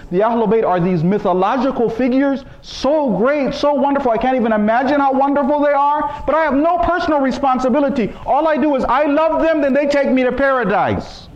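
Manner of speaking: high, sing-song mock of a lazy believer; a sarcastic imitation before the sharp "No."; mocking complacency. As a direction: parody the one who only loves and does nothing.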